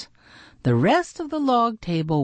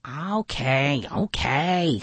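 grudging weasel voice; Snout voice; 2 semitones lower, slower than usual. A narrator's reading. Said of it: "Snout said".

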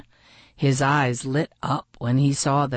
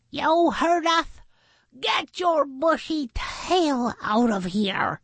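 high creature voice, mild yell; Sal yelling; 10 semitones higher than usual. A narrator's reading. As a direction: chime in feistily from a hiding place. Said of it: "Sal yelled".

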